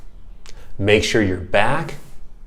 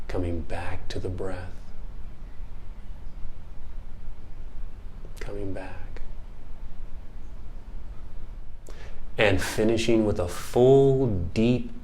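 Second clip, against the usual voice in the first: hushed, unhurried mindfulness guide; guided meditation; 2 semitones lower, 8 dB quieter than usual. Acted. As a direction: close the exercise gently, leaving long pauses. Leads a body scan.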